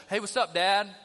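loud breezy "Hey, what's up, Dad?"; flippant, casual. Retold or imitated. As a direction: toss it off like a casual greeting. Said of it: "flippant, casual".

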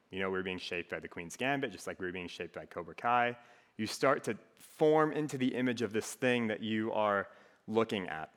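ordinary delivery

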